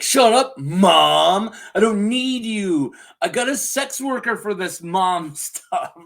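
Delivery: loud bratty outburst at Mom; hammed-up teenage tantrum, starting loud and easing; mock angry son. Host as son snapping at his mother, mock exasperated.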